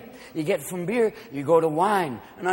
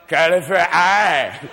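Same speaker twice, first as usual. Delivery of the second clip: put-on drawn-out drunken slur; slurred drunk; 3 semitones lower, much slower than usual. Drunk wandering around on a hot day, slurring slowly. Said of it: "You're speaking fluent drunkenese".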